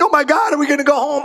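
mock panic about never getting home; panicked friend. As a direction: Brazilian friend squealing in mock fear. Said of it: "He's like".

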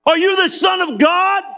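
sneering accuser shouting at Jesus; challenging shout. Acted. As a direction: hurl the question up at him like a jeering crowd.

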